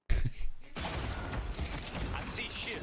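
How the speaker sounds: airy giggle; breathy high laugh. Real, not acted. Cracking up at his own imagined argument with his wife.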